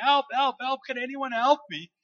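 loud, high, drawn-out calls; shouting for help. Acted. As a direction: yell desperately for anyone to rescue you. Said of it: "and shouting".

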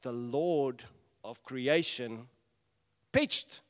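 slow, loud, weighty announcement; dramatic reveal. Acted. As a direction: land the reveal word by word. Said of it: "It's like".

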